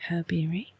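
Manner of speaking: ordinary talk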